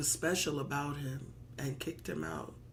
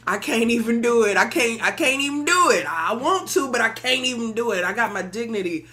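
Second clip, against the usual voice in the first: louder and higher, proud indignation; louder indignant refusal; 4 semitones higher, 10 dB louder than usual. Acted. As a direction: shout your dignity back at them. Preacher voicing his refusal.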